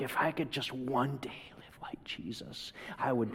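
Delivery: breathy hushed whisper. Pretend. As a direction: whisper it like a secret longing. Imagined wish in a whisper.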